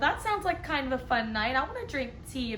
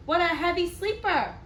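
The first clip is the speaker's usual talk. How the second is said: ordinary delivery, nothing marked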